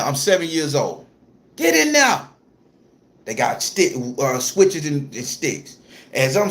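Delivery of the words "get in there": high, loud bark, Get in there; yelled order. Retold or imitated. Grandma orders the crying boy into the pot, yelling. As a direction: force the kid's hands in.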